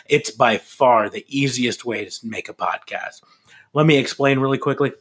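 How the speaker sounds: ad read. Acted. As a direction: pitch the sponsor like an ad. Sponsor ad read.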